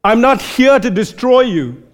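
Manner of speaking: fervent reassuring vow; God's warm promise. Retold or imitated. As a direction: speak as a loving father vowing protection. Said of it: "his posture is like this".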